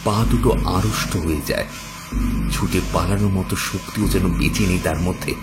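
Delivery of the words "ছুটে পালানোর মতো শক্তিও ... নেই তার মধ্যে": usual speaking voice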